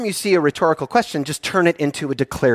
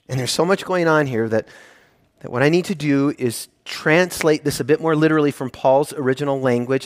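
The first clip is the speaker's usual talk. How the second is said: normal talking voice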